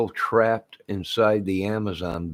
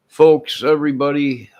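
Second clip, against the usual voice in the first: calling out; 7 semitones higher, 8 dB louder than usual. Real, not acted. Calling out to viewers.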